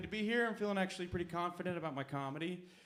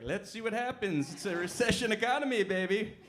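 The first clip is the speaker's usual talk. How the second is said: loud, higher cheeky shrug; smug scheming company; 7 semitones higher, 7 dB louder than usual. Retold or imitated. The therapy company shrugging off the scam, loud cheeky voice. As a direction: gleefully admit the scam. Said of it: "And just like".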